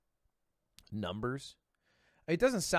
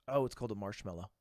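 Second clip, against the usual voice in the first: usual speaking voice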